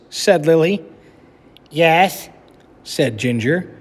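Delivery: lively storybook narration for a class; reading aloud to children. A narrator's reading. Teacher reading a picture book to her class, animated storytelling. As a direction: read it like story time for kids.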